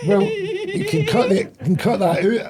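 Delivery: high, drawn-out words through laughter; laughing protest. Real, not acted. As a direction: plead through a real laughing fit. Caught on iPad, embarrassed laughter.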